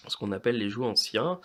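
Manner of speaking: normal talking voice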